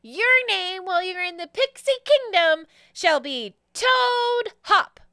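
high, loud pixie showman voice; Jeremy the pixie. Acted. Pixie bestows a new name on a newcomer, high and proud. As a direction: crow it out in a high character voice. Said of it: "and he says".

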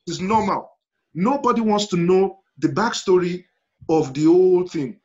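ordinary delivery

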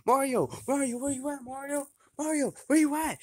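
frantic calling. Real, not acted. Searching frantically during fight.

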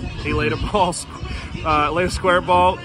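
usual speaking voice

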